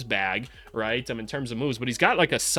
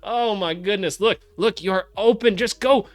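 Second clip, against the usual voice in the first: excited urging; 9 semitones higher, 6 dB louder than usual. Real, not acted. Urging player on film.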